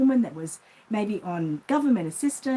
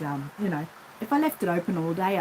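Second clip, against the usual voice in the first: ordinary talk, nothing marked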